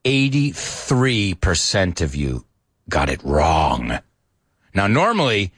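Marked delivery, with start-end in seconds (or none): 0.0-4.0 slow, low, mock-tragic lament; eighty-three percent drawn out in fake sorrow; mock crying. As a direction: grieve over the score, hammed-up. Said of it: "I'm really, really crying".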